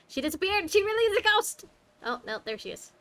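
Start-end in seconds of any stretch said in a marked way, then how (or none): 0.1-1.6 sudden rise, much higher and louder; delighted shock at a real ghost; startled. Real, not acted. Startled when the ghost girl vanishes.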